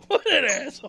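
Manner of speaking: loud, high, laughing shout; cracking up at being pranked; slow, laughing exclamation; laughing outburst. Real, not acted.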